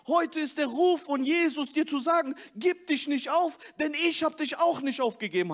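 rousing sermon voice, pushed and driving; fervent preaching. Acted. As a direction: preach it to the whole hall, building. Preaching at full force.

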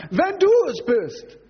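high, loud, drawn-out mimicry; mocking imitation. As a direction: throw his words back mockingly, stretched out. Mocks Petrus' reply again. (laut und gedehnt nachgeäfft)

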